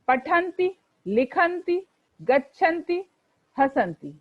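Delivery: पठति लिखति with short final i, recited clearly; correct pronunciation. Shown as a demonstration. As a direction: chant each verb form with short endings. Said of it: "पठते समय पठति बोलेंगे".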